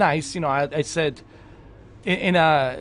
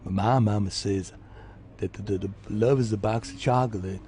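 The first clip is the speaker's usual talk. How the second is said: Forrest Gump voice; 6 semitones lower than usual. Retold or imitated. Quoting mama's chocolate line as Gump. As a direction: quote his mama like Forrest Gump. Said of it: "it's more like a Forrest Gump".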